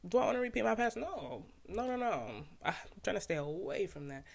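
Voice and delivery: ordinary delivery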